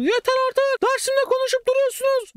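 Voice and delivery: very high screeching yell of an angry teacher; mock the hysterical teacher's squeaky voice; high squeaky teacher losing it; shrill teacher imitation. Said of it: "kadının da sesi böyle aşırı ince".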